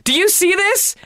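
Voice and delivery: high-pitched comic outrage; exasperated scolding. Real, not acted. Mock scolding over empty makeup.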